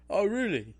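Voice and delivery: high, loud hammed-up angry retort; mock furious chef. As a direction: fire it back like an outraged celebrity chef. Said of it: "and he's like".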